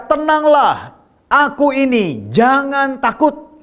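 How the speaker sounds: high, drawn-out scripture proclamation; proclaiming as Jesus. Acted. Jesus calling to his frightened disciples, high slow proclamation. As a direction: stretch each phrase, pitched well up. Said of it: "segera Yesus berkata kepada mereka".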